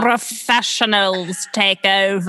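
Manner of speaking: hammed-up British accent, slow and drawn out; performer's exaggerated fake British accent; mock British accent. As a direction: put on a bad theatrical British accent. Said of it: "He does this funny British accent".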